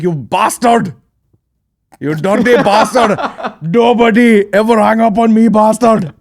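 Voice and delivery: high, loud hammed-up rant at the caller; mock furious yell. Imagined caller yelling at him for hanging up, high loud mock rage. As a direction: bellow it as a pretend angry caller. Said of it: "I'd be like".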